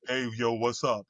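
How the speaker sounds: dropped low into a put-on tough greeting. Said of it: "you sound fake to me".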